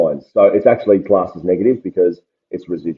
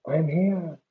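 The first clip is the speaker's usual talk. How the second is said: pitched-up headphone voice filter; voice changer; 10 semitones higher, 8 dB quieter than usual. Shown as a demonstration. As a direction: run it through a higher voice effect. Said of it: "I wanna change my voice".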